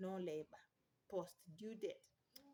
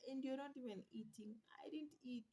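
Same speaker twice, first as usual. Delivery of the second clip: whispered aside; 3 semitones higher, 6 dB quieter than usual. Real, not acted. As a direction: whisper it as a confession. Unvoiced whisper.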